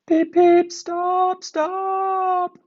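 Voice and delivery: very high, loud frantic whistle; little engine whistling in panic; whistling alarm. A narrator's reading. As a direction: cry for it to stop like a squealing whistle. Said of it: "whistled Thomas".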